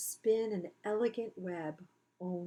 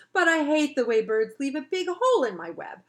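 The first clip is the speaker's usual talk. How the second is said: emphatic complaint; 7 semitones higher, 10 dB louder than usual. Acted. Spider confiding loudly.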